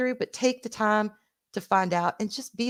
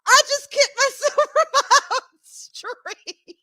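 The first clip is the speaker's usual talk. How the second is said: high-pitched, slow, laughing through the words; real giggle at her own blunder; 4 dB louder, slower than usual. Real, not acted. Streamer to her chat after kicking herself off, laughing.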